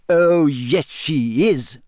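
triumphant yes, she is; happily. A narrator's reading. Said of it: "said Hermione happily".

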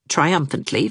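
plain reading voice

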